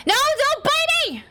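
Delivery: very high, squealed protest; real high-pitched exclamation; high yelp. Real, not acted. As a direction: squeal at the pet to stop biting. Pet bites her mid-stream.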